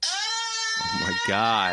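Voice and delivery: long high squeal, then a gasped exclamation; mock terrified squealing; playfully scared. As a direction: act scared out of your pants. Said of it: "that was you getting scared".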